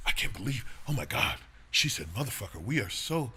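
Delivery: put-on scandalized gasping disbelief; imitating phony offended outrage; mock outrage. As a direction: act hugely offended, mockingly. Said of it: "this sensitivity and this artificial".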